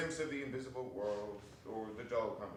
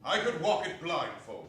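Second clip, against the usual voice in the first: a louder eager "Remember"; fervent cry; 5 semitones higher, 8 dB louder than usual. Acted. Scrooge answering the spirit, fervent cry. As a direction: cry it with fervor. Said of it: "cried Scrooge with fervor".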